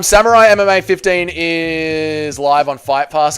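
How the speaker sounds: ordinary talk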